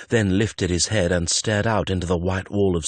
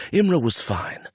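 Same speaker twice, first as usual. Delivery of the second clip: high, anxious repetition; worried self-soothing; reassuring himself; 8 semitones higher than usual. A narrator's reading. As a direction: insist to yourself, pitched up. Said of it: "he told himself".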